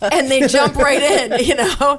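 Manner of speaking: laughing while talking; giggling through the words. Real, not acted.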